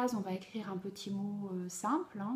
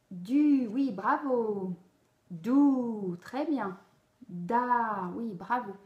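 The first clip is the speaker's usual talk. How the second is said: cheerful praise; 5 dB louder, much slower than usual. Acted. Teacher plays the child reading.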